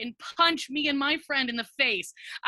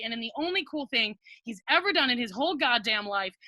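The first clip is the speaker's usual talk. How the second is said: ordinary talk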